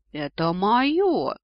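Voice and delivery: loud, drawn-out roar rising in pitch; monster roar. A narrator's reading. Monster guarding its lair from the intruder, roaring. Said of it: "взревел монстр".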